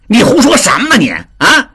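音调高、语速快的急眼争辩; 急得脸红脖子粗地辩白; 急赤白脸. A narrator's reading. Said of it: "达庆急赤白脸地说". (达庆被人揭短，急赤白脸地高声辩解)

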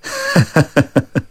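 soft, steady acted ha-ha; pleased little laugh; amused chuckle. Fortune-teller sees the same character, chuckles. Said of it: "乐了".

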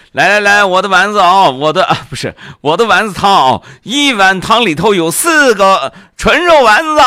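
loud, high hawker's call; street vendor hawking. Acted. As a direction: call out to passing customers. Rival soup vendor hawking customers.

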